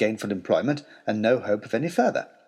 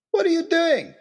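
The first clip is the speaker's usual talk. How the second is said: demanding. Acted. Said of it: "he demanded".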